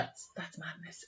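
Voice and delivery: breathy whispered awe, slow; hushed. Real, not acted. Host whispering her shock at his past, slow. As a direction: drop to a whisper of disbelief.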